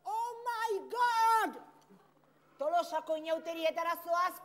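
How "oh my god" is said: a slow, high gasp of surprise; shocked. Acted. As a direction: sound floored by the outfit. Examiner sees the costume. (harrituta, astiro eta goi tonuan)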